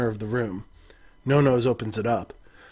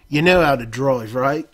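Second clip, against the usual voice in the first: louder, broad Cockney on "drive, right"; Cockney accent; 6 dB louder than usual. Acted. No-Nose tossing the keys to the player, Cockney accent. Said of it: "Forgot the accent".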